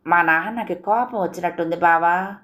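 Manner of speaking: usual speaking voice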